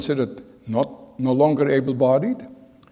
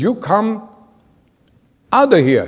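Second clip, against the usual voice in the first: loud, higher command; guard's order; 7 semitones higher, 6 dB louder than usual. Retold or imitated. Guard ordering the boy out of the warehouse, loud command. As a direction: order him out sharply.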